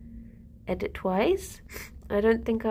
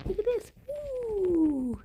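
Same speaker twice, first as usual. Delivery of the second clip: cooing; 10 semitones higher, 3 dB quieter, much slower than usual. Real, not acted. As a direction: coo at the bunnies. Cooing at rabbits.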